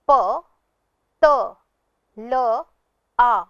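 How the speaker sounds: प त ल आ, each sound apart; spelled sound by sound. Shown as a demonstration. Teacher to pupils, sounding out पतला. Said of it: "अगले शब्द को पढ़ते हैं".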